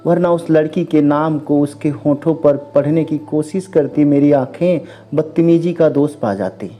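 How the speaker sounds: usual speaking voice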